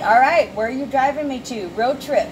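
lightly voiced eager passenger; a hint of a partner piping up; upbeat partner. Partner getting in the car, lightly acted upbeat call. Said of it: "and they're like".

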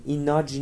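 ایناج ending in a J sound instead of F; wrong pronunciation. Shown as a demonstration. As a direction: misread ENOUGH as ایناج, with a final J. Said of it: "ایناج نیست". (تلفظ غلط «ایناج» با «ج» در آخر)

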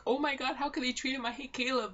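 acted dismayed protest; mock outrage. Imagined reaction of a Caleb supporter.

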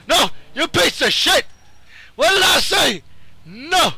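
loud, high yell at an enemy; angry outburst. Real, not acted. Gamer scolding Dragon Faust mid-fight, angry outburst. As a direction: shout it in exasperation.

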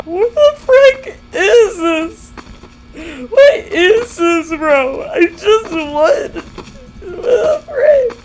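high, slow, half-crying half-laughing; disbelieving wail. Real, not acted. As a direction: whine in stunned disbelief.

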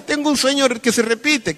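high, whining patient; mock crying. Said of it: "Va llorando donde el psiquiatra". (paciente llorón, voz aguda)

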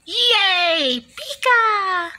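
very high, loud squealed cheer; gleeful cheering. Acted. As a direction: celebrate with a drawn-out squeal. Cheering at being allowed pet.

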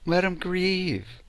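slow, drawn-out "Let 'em grieve"; slow repeat. Shown as a demonstration. Healer to listeners, repeating the advice slowly. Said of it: "Let 'em grieve".

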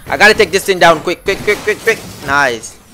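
ordinary delivery

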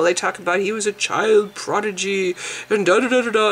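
ordinary talk

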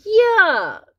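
high, loud burst of disgust; big theatrical yuck; disgusted cry. Acted. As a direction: cry it out, pitch way up. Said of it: "cried Froggy".

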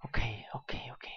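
softly whispered okays; calm yourself in a breathy whisper. Real, not acted. Tense moment, whispering.

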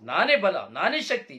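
loud, high and fast, cocky son bragging; boastful shout. Acted. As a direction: shout it like a proud son showing off. Son boasting loudly to his father. (ಅಪ್ಪನ ಮುಂದೆ ಜಂಬದಿಂದ ಕೂಗುವ ಮಗ)

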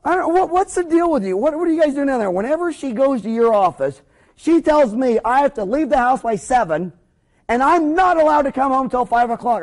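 high, agitated husband voice; imitated indignant demand. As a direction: act out a husband airing his grievance. Acted husband demands an explanation.

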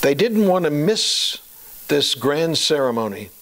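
ordinary delivery

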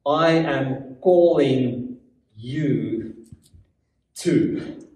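slow, low, solemn pronouncement; weighty, unhurried divine call; God speaking. Acted. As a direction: speak as God, pausing between words. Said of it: "God says".

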